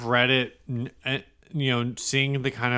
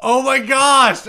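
shocked exclamation; 9 semitones higher, 7 dB louder, slower than usual. Real, not acted. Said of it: "you do it in a Mexican accent".

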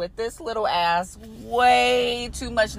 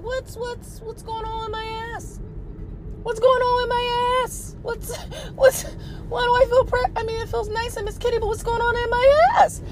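high, alarmed panicky squeal; panicking about what's happening; shocked squeal; 11 semitones higher than usual. Retold or imitated. To her partner in bed, a high alarmed squeal.

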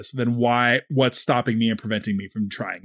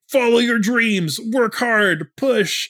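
mock shonen pep talk; 9 semitones higher, 3 dB louder than usual. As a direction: parody a cheesy anime hero's rallying cry. Mocking clichéd shonen message.

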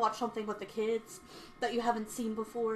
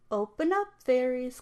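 bang out the call through the door; loud call; 3 semitones higher, 5 dB louder than usual. Acted. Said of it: "called out a loud voice".

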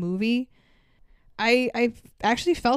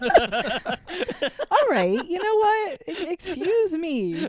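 mock offended; 7 semitones higher, much slower than usual.

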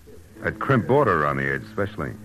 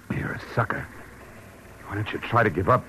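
hushed sneer; 6 semitones higher than usual. Acted. Tough guy taunting at knifepoint.

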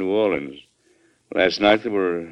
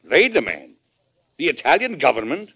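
higher and louder, each question sharper; indignant disbelief; 7 semitones higher, 5 dB louder than usual. Acted. President to secretary of state, indignant disbelief. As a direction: bristle at the foreign demand. President hears Italy's demands.

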